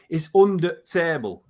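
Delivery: mild Yorkshire voice dropping the article before "table"; a light Yorkshire "It's under table"; Yorkshire accent. Shown as a demonstration. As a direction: say "under table" like a Yorkshire local pointing something out. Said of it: "They'll say".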